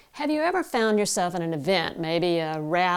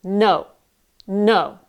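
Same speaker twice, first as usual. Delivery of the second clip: firm, louder no aimed at the palm; projected no; 6 dB louder than usual. Shown as a demonstration. Coach shows the palm exercise, forceful louder no. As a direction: push no into your hand with force. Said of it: "Try that with me".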